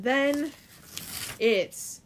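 slow, teasing announcement; drawn-out buildup. Real, not acted. Storyteller building up to the big reveal, drawn out. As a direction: stretch it out slowly for suspense.

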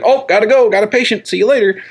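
high-pitched mock excuse; hurried brush-off. Secretive doctor dodging a lady's questions, hurried brush-off. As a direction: blurt out excuses to get away.

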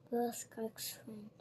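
ordinary delivery, nothing marked

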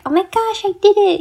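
high, loud thrilled cry; excited. Retold or imitated. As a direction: burst out with joy. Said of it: "that rush of".

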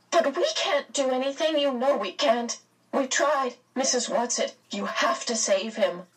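high, insistent appeal; urgent pleading. A narrator's reading. Meg insisting Mrs Whatsit must save him, pleading. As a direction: argue and beg, starting high and easing down. Meg arguing and begging for help.

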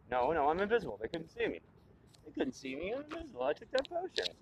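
panicky. Acted. Panicked self-reassurance.

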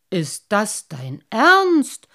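loud, slow, relieved disbelief; hardly daring to believe the good news; relieved exclamation. A narrator's reading. Father hears the carp will carry his son, loud relieved disbelief. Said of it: "rief der Wassermannvater erleichtert".

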